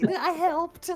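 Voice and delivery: high imp voice with a giggle; pleased little demon. Acted. Handless demon looks around the group, pleased high giggle. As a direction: say it proudly up high, then giggle. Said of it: "just like".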